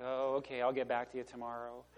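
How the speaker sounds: quiet, bored shrug of a reply; mock indifferent. A preacher mocking unexcited rookies, bored and quiet. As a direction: sound like the call means nothing. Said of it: "They're like".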